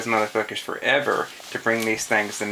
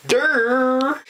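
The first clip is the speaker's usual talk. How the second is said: mock dumb noise; 13 semitones higher than usual. Mocking stupid cops.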